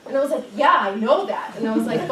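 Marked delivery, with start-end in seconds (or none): 0.5-1.5 exasperated. Retold or imitated. Arguing with her grandmother.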